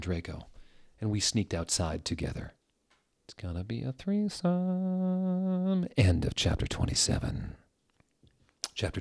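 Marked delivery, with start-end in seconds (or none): 3.2-5.9 much higher, slow put-on voice; slow, sing-song mock tease; mock swooning voice. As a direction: coo it in a mocking falsetto. Mocking the story's hint.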